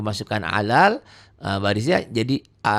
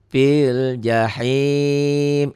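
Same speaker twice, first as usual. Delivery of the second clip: slow tajwid chant; Quran recitation; 3 semitones higher, much slower than usual. Shown as a demonstration. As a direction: draw the words out like a reciter. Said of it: "jika dihentikan". (lantunan tartil pelan)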